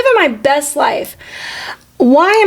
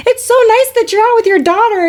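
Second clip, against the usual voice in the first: mock sweet stranger; 10 semitones higher than usual. People mistaking husband for dad.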